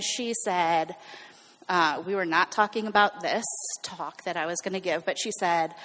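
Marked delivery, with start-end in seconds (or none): none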